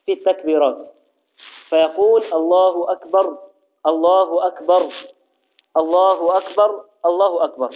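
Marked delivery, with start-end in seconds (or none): 2.3-7.7 each أكبر stopped on a silent R, unjoined; unvowelled final R. Shown as a demonstration. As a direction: end every أكبر on a bare R, then break. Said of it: "التربيع مع تسكين الراء".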